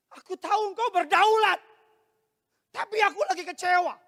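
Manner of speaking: loud, high cry to God; impassioned lament. Acted. Disappointed believer crying out to God, impassioned lament. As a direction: cry it out in acted anguish. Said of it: "Itu ratapan".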